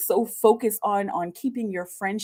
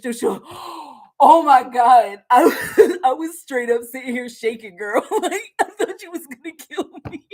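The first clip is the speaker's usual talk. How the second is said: mock relieved friend, laughing; 4 semitones higher, 8 dB louder than usual. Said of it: "voicing the friend's relief".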